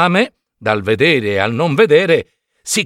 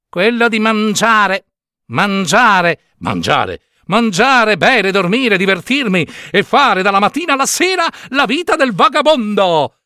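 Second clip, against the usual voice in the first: gleeful puppet; 7 semitones higher than usual. Acted. Pinocchio boasts of eating to the cricket, gleeful.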